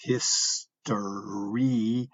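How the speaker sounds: "hystery" drawn out slowly with a long E sound; slow breakdown of "hystery", long E stressed; slow syllable demo. Shown as a demonstration. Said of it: "that's a long E".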